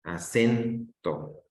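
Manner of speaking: a-CEN, then "to", split slowly; stress on middle syllable. Shown as a demonstration. Teacher confirming the answer, "Acento" stressed on "cen". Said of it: "ahí va tu acento".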